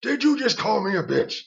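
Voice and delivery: Robert repeating it, angrier. Retold or imitated. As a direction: push the outrage further the second time. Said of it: "He jumped up".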